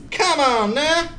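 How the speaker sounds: high, sing-song mock plea; high silly voice. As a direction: squeak it like a cartoon. Repeat in silly voice.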